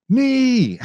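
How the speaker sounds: sharp high shout of knee; yelled knee. Retold or imitated. Gym bystander shouting for a knee. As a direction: yell it across the room. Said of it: "Somebody yells".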